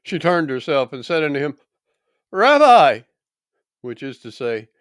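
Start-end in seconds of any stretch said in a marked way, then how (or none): 2.3-3.0 loud, high exclamation; astonished recognition. Acted. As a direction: cry out on recognising a beloved teacher. Woman recognises her risen teacher.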